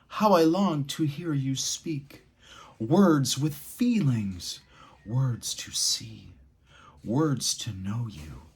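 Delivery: slow expressive poem read, loud peaks and a whisper; dynamic dramatic reading. Shown as a demonstration. Teacher showing the lively way to read the passage, dramatic. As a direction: make every line come alive with big contrasts. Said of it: "I'm gonna read it the second time".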